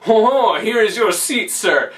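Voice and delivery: mock waiter voice. French waiter leads him to toilet.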